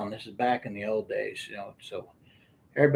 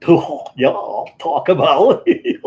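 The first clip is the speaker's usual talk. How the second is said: laughing mock reply; 4 semitones higher, 10 dB louder, slower than usual. Retold exchange with the kid.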